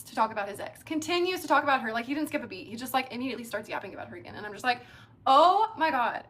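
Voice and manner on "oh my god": loud, higher exasperated outburst. Retold or imitated. Woman hearing about her date's ex yet more, exasperated. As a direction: let the exasperation burst out.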